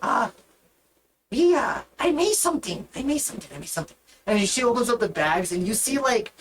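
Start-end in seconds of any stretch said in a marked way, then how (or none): 0.0-4.0 high, excited goblin, fading down. Acted. Goblin girl showing off what she made, high then fading. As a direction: blurt it out proudly, then trail off.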